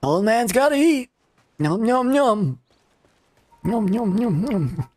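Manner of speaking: put-on old man voice. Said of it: "old man voice".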